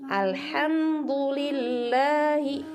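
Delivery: "Alhamdulillahi" slow, light open lla; thin lam recitation. Shown as a demonstration. Teacher shows how it goes, "Alhamdulillahi" slow with thin lam.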